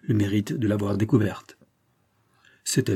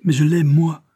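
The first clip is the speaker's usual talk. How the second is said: raised, higher voice in a heartfelt outburst; impassioned cry; 6 semitones higher, 7 dB louder than usual. A narrator's reading. Woman protesting her love to the agent, impassioned cry. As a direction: cry it out with fervour. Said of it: "s'écria-t-elle".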